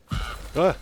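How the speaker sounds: startled scream at a jump scare; sharp frightened yelp; scared horror game streamer. Real, not acted. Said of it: "that jump scared me".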